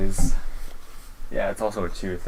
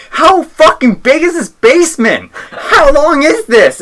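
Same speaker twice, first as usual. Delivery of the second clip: screamed at full force, much higher and louder; top of my lungs; 12 dB louder than usual. Retold or imitated. Friend in a dark haunted basement, screaming at the top of his lungs. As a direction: yell it as loud as you can. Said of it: "at the top of my lungs".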